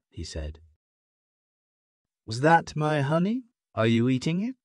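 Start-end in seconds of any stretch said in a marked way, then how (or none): 2.3-4.6 suspicious questioning. A narrator's reading. Lion suspects monkey again.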